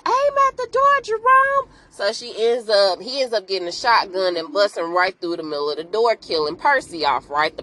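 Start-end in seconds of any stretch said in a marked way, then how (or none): 0.1-1.7 much higher, louder urgent shout; a frantic high plea to her man; urgent high call. Retold or imitated. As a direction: yell the order from behind the door. Hostage urging rescuer to shoot.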